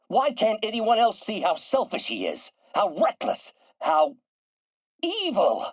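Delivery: high-pitched, impassioned outburst; desperate, indignant rant; exasperated fury. Acted. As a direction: build to an anguished peak on evil. Rants about Norman.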